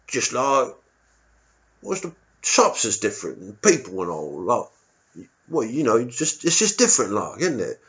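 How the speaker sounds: a cagey East Londoner dodging the question; a touch of a cagey Londoner; vague local. Retold or imitated.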